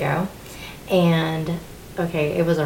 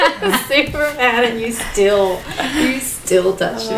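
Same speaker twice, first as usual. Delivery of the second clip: loud confession through laughter; laughing; 5 semitones higher, 6 dB louder than usual. Real, not acted. Woman testifying that God met her despite anger, laughing. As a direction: laugh in amazement as you testify.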